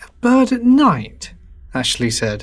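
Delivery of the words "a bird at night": high, slow skeptical question; drawn-out, high-pitched doubt; incredulous. A narrator's reading. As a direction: echo it back in disbelief. Ashley doubts the bird explanation.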